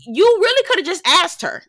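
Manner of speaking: high, exasperated plea. Real, not acted. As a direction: raise it in disbelief. Exasperated aside.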